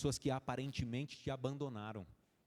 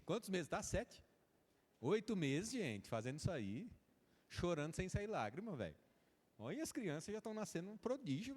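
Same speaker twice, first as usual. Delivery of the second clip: ordinary delivery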